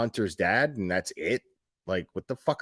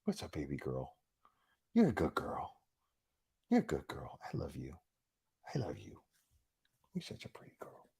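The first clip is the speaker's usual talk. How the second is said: much softer, whispered doting; whispering to the dog; 7 semitones lower, 14 dB quieter than usual. Real, not acted. To her dog Panda, softly whispered baby talk. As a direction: murmur sweet nothings to a pet.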